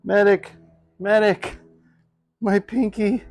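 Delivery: high hammed-up cry for help; playful battlefield yell for a medic; man down shout. Jokingly calling for help after cut.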